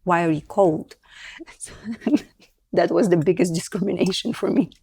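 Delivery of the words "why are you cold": ordinary talk, nothing marked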